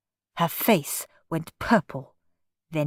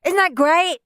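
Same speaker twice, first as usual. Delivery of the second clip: put-on high-pitched gleeful child; excited little show-off; delighted boy; 10 semitones higher, 4 dB louder than usual. A narrator's reading. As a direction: crow it proudly in a boy's voice. Henry pleased with his show.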